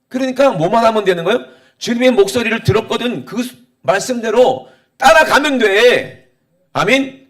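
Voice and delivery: high, loud exhortation, slowing hard at the close; forceful preaching. Real, not acted. Preacher urging the congregation to follow the word, forceful. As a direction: drive the point home to the congregation. Preacher drives the point home.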